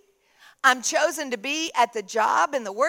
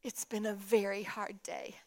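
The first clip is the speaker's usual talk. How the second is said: soft, low, weary admission; subdued confession; 4 semitones lower, 10 dB quieter than usual. Retold or imitated. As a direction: imitate a tearful woman quietly confiding. Tearful woman admits her hard day.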